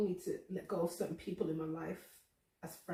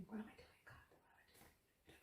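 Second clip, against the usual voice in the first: much softer, dying away into breath; fading whisper; 3 semitones higher, 20 dB quieter than usual. Retold or imitated. Asking God what she is doing, in a fading whisper. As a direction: let the question vanish to nothing. Doubting herself before God.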